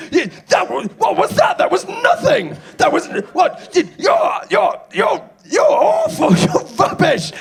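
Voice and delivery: high, loud outraged yelling; angry heckler ranting. Retold or imitated. Furious punter yelling at the comedian in the bar. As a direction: scream it, beside yourself.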